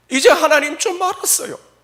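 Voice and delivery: high, loud, fervent cry; crying confession. Acted. Job confessing to God, high and fervent. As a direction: pour out the confession. Job's final confession, cried out.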